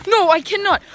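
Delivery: high, loud protest; loud refusal. Real, not acted. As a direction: refuse it, half laughing. Refusing to jump into snow.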